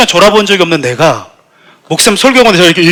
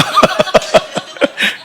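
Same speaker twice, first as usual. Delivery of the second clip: high breathy burst of laughter; real laugh; 14 semitones higher than usual. Real, not acted. Pastor laughing at his younger fiery self, breathy laugh.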